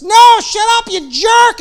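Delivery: loud, high snapping shout; mock angry shout. Peter yelling at the stranger on shore, mock angry shout. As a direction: yell it back like a grumpy fisherman. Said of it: "And Peter's just".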